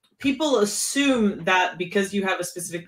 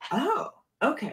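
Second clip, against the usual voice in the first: softer, higher-pitched acted realization; mild realization; 6 semitones higher, 6 dB quieter than usual. Retelling a fellow player accepting the reasoning, mild realization. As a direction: imitate quietly taking the point. Acting out his dawning understanding.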